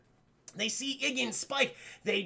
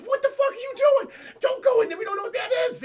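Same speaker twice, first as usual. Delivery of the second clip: high, loud panicked yelling; a brother yelling in disbelief, high and loud; frantic yell; 14 semitones higher, 7 dB louder, faster than usual. Retold or imitated. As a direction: scream at him not to go in. Mario yells at Luigi to stop.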